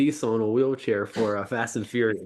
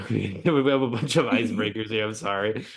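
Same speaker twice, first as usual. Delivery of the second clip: usual speaking voice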